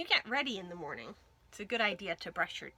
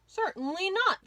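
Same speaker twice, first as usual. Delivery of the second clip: firm dentist; 9 semitones higher, 5 dB louder than usual. A narrator's reading. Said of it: "said Doctor Crunchew".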